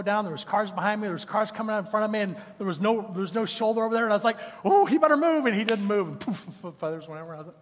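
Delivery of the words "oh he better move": pitched up high and louder, urgent; will the bird to get out of the way; a driver's tense cry, retold higher and louder; urgent exclamation. Retold tense moment, dove in road.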